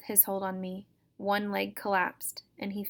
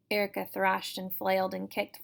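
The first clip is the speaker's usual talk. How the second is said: usual speaking voice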